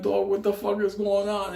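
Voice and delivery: high, amazed question; hammed-up what-is-going-on; mock disbelief. Asking the returned friend.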